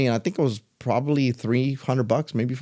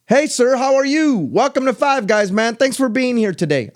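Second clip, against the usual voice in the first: put-on eager cashier kid; 9 semitones higher, 4 dB louder than usual. Imitating the Five Guys cashier kid greeting him, high and beaming. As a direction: greet like the happiest kid at the register.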